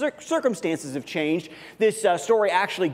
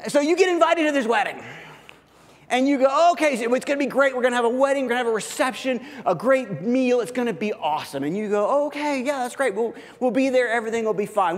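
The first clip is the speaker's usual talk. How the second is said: higher, eager reply to the neighbor's news; overjoyed invitation; 7 semitones higher than usual. Acted. Pastor playing a thrilled neighbor who got invited, gushing happily. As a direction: gush happily about the wedding plans.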